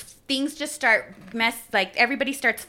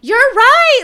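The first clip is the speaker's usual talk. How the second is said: much higher and louder, a burst of recognition; sudden realization; 13 semitones higher, 12 dB louder than usual. Retold or imitated. Realizing her friend is right, loud and high. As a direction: blurt it as it hits you.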